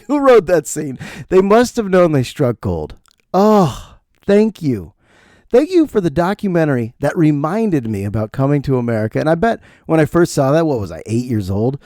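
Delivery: ordinary delivery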